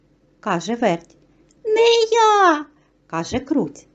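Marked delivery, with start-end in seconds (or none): 1.6-2.7 high, drawn-out mouse voice; refusing little mouse. A narrator's reading. Second mouse refuses the chore.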